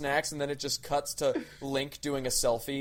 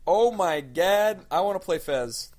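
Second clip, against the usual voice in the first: thrilled; 3 semitones higher, 4 dB louder than usual. Real, not acted. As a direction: stretch it out, thrilled. Delighted by free Fez news.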